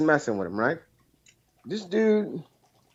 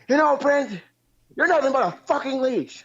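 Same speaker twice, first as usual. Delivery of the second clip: much higher mock rant at a buddy; imitating angry friend; 11 semitones higher than usual. Caller imitating his friend Bullfrog telling him off, high mock rant. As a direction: whine out his friend's furious brush-off, pitched way up.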